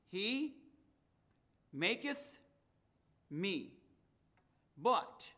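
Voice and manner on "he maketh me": "He", "maketh", "me" set apart by long pauses, each emphasized; emphasize "He", then "maketh", then "me", one at a time; stress on each word. Shown as a demonstration. Preacher showing three words you can emphasize, each stressed alone. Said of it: "three words that you can emphasize".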